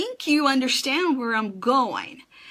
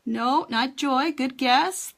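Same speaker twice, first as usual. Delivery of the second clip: ordinary delivery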